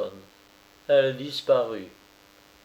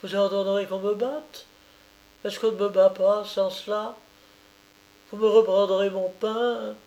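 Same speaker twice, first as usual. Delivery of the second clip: higher, bitter and defiant; lightly voiced bitter peasant woman; 8 semitones higher than usual. A narrator's reading. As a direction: throw his power back at him. Oulana lists her fears.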